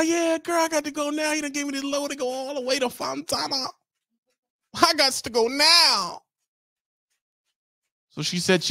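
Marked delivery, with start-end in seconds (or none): 0.0-6.2 high mock voice; mock driver. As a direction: play it sad but eager.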